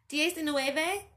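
a server calling a number across the restaurant. A narrator's reading. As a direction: call the order number out loud. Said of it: "the woman called out again".

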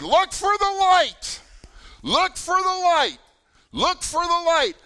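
high, full-force distant call; screaming back. Retold or imitated. Counselors call to a lost camper, screaming back. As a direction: holler each word to carry through the dark. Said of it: "we started screaming back to the person".

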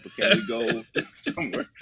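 laughing. Retold or imitated. Acted restaurant chat, laughing.

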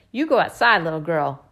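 loud order down the stairs; yelled order. A narrator's reading. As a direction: bark it from upstairs. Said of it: "Uncle Rich yells back".

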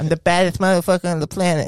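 high, fast lisping boxer voice; hammed-up Mike Tyson; Mike Tyson impression. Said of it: "sounds like that".

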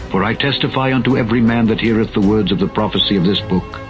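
normal talking voice

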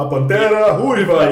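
slow, loud cartoon-hero announcement; Pantera Ruiva. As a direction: boom out the hero's name slowly. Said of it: "então ele é uma voz mó grossa". (grito lento e forte de herói de desenho)

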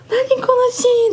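high, slow, stunned gasp of wonder; overwhelmed by how beautiful it is; drawn-out amazed cry; awestruck exclaim. Retold or imitated.

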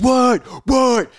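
high, loud startled questions; alarmed shouting. Retold or imitated.

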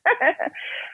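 high, embarrassed burst of laughter; mortified laugh. Real, not acted. Retelling a phone call blunder, laughing, mortified. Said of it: "I'm laughing about that 'cause I'm mortified".

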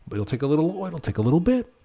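a lightly voiced manager promising the pineapple; imitating the manager. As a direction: play the polite manager stalling. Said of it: "He goes".

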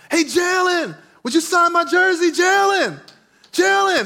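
high, loud shouting to a player; kid yelling. Retold or imitated. Kid at the game begging players, yelling. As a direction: call out over the crowd for an autograph. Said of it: "this kid is just yelling out".

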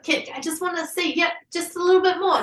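high, coaxing plea; pleading husband. Retold or imitated. Husband filming asks her to move her hand, high coaxing. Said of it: "He's like".

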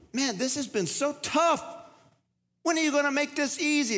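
high whining plea to God; whiny complaint. Retold or imitated. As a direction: whine like a worn-out child. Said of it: "It's like".

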